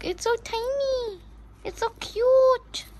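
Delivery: very high cooing squeal; cute gushing. Real, not acted. Gushing over how tiny and cute it is, high squeal.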